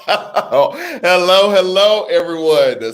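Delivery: hello through real giggles; giggly hello to the viewers; laughing greeting. Real, not acted. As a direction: greet them while cracking up. I'm over here laughing.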